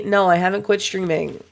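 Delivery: ordinary delivery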